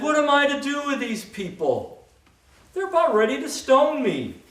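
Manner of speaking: high, desperate plea; Moses crying out. Acted. Moses crying out to the Lord, high desperate plea. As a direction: plead with God in despair.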